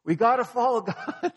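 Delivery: high, loud call up from a pit, laughing through it; hammed-up prophet yelling from below; laughing mock yell of a trapped prophet; mock shout from the well. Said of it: "he's shouting from the well".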